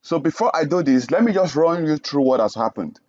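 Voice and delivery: ordinary talk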